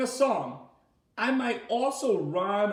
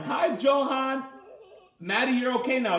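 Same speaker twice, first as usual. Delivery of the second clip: laughing greeting; 5 semitones higher, 3 dB louder than usual. Real, not acted.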